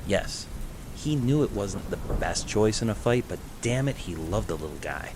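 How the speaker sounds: ordinary delivery, nothing marked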